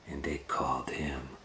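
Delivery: soft hushed storytelling; story whisper. A narrator's reading.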